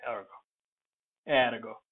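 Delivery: ergo with open "air" and a sounded R; Latin pronunciation. Shown as a demonstration. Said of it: "speakers will pronounce it like that".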